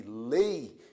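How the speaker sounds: "lei" with the E stressed, gliding to a weaker I; E standing out. Shown as a demonstration. Teacher showing the falling diphthong, "lei" stressed on its E. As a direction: lean on the E in "lei". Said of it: "primeiro se destaca a letra".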